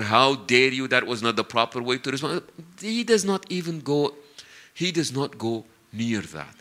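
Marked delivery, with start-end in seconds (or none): none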